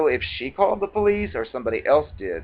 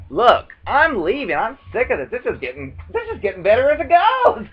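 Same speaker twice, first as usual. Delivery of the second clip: sarcastic; 8 semitones higher than usual. Retold or imitated. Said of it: "you may not have the sarcasm, but I didn't".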